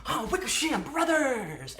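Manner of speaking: high-pitched cawing impression; Wickersham brothers. Fan re-enacting the brothers coming out on stage, high mimicking voice. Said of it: "And they were like".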